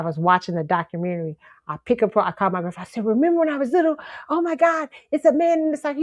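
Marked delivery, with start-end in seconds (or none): 3.1-6.0 high-pitched, breathless-with-news retelling; excited recognition. Retold or imitated. Granddaughter phoning grandfather about the statue, excited. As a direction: blurt out the discovery, pitched way up. Frantic phone call to grandfather.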